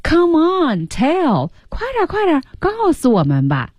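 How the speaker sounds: high, pushy begging; eager urging. Retold or imitated. Lion pressing his friend for the wish, eager. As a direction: hurry him along. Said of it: "狮子继续说".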